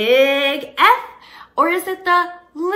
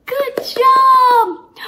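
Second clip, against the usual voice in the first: excited praise; 9 semitones higher, 7 dB louder than usual. Real, not acted. Praising the child.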